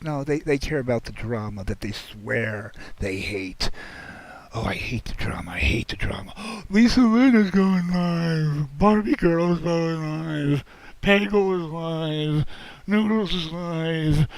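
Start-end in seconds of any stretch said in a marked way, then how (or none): 4.5-6.6 sneering whispered mockery; mocking whisper. Mocking viewers who claim to hate drama. 6.7-14.3 gasping, slow and breathless excitement; excited gasp. Pretend. Excited alerts as channels go live.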